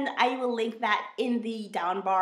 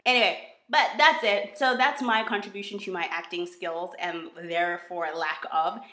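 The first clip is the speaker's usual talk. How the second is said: ordinary delivery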